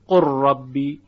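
قر ربی with the lam fully merged into a doubled ra; full assimilation. Shown as a demonstration. Teacher demonstrating full assimilation of قر ربی. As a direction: say قر ربی as one heavy doubled ra, no trace of lam. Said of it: "هیچ اثر صوتی از لام باقی نیست".

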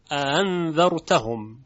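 انذرتهم slow, second hamza gliding like a plain short a; softened hamza. Shown as a demonstration. Teacher demonstrates tashil, slow softened recitation. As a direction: recite انذرتهم slowly with no second glottal stop.

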